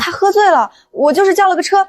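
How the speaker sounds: flustered protest. Acted. Defends herself against colleague's gossip. (慌忙辩解)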